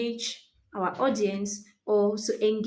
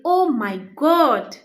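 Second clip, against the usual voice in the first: loud, slow, excited gasp of words; a high, loud oh my God; excited exclamation; 4 semitones higher, 8 dB louder, slower than usual. Shown as a demonstration. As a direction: let the excitement burst out. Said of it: "That's excitement".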